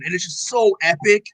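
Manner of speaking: ordinary delivery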